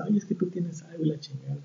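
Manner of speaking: breathy whisper of a mock girlfriend; whispered complaint. Imitating his date's sulky complaint, whispered. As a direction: hush it like a sulking date.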